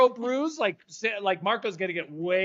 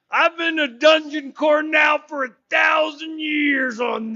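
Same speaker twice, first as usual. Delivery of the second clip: put-on slow, booming old-creature voice, louder; ancient dungeon core; 4 semitones higher, 4 dB louder than usual. Resnick the dungeon core answering the boy, slow and booming. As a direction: proclaim it like an ancient being. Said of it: "Resnick says".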